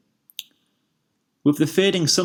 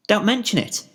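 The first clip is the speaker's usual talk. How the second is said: high, bright chirp; chirpy; 8 semitones higher than usual. A narrator's reading. Pinkie chirping back to thankful Spike. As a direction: toss it off cheerfully. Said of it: "she chirped".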